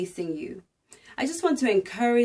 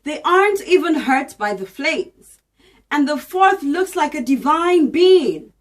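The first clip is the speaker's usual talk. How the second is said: higher, louder storytelling read of scripture; dramatic Bible reading; 4 semitones higher, 6 dB louder than usual. A narrator's reading. Reading Daniel aloud to viewers, dramatic proclaiming.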